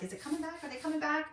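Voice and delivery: high, puzzled little dog voice; mock-worried pup wondering aloud; voicing the dog. Dog watching the car leave, high and puzzled.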